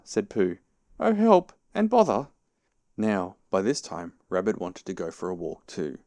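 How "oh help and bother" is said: high, loud wail falling at the end; exasperated cry for help. A narrator's reading. Pooh unable to move either way, exasperated cry.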